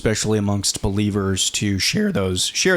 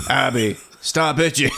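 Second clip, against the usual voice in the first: higher mock daddy telling-off; mimicking dad's voice; 4 semitones higher than usual. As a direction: kid imitating dad, on the edge of laughing. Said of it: "He totally tried to mimic me".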